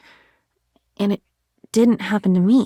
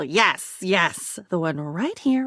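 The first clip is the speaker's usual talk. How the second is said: usual speaking voice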